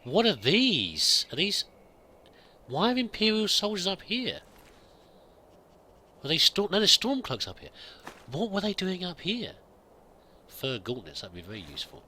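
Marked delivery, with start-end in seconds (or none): 0.0-9.5 surprised. Real, not acted. Surprised at finding soldiers' bodies.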